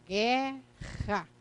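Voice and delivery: "guerra" stretched into gue... rra, U silent; phonetic pronunciation. Shown as a demonstration. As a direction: sound out "guerra" with two long, separate syllables. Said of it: "da fonética". ("guerra" pausado e prolongado: gue - rra)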